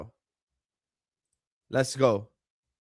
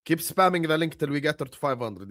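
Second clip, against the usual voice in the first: ordinary talk, nothing marked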